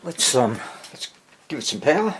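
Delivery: normal talking voice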